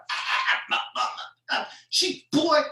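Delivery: put-on stammered breathy syllables ending in a loud high boy; deaf accent stammer. Caught son stammering before mama: breathy sputter, loud high boy. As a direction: sputter like a scolded kid, then bark boy. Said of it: "deaf accent".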